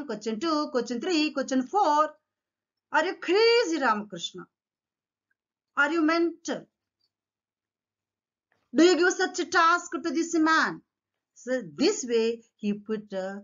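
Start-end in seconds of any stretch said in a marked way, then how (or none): 3.0-10.7 high-pitched acted barrage of questions; mock interrogation. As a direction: grill him as the indignant king would, lightly acted. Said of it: "So he interrogated the people".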